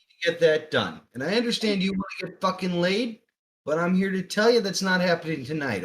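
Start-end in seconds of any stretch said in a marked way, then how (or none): 1.1-5.8 stern telling-off. Acted. Player scolds Fabio firmly.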